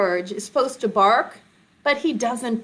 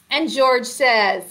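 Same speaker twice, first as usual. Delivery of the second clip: ordinary delivery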